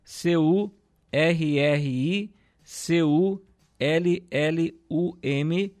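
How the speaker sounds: slow letter-by-letter spelling of C U R R I C U L L U M. Shown as a demonstration. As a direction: spell each letter of C U R R I C U L L U M slowly and clearly. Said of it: "Vou até soletrar pra você aqui".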